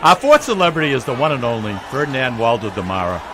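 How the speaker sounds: ordinary delivery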